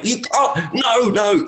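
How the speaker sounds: high, exasperated refusal; mock protest. Player protesting at his caddy's repeat game, mock anger. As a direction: imitate someone refusing to be caught out, pitch way up. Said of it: "I'm so angry at you".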